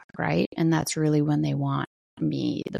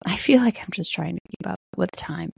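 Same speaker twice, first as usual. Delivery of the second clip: ordinary talk, nothing marked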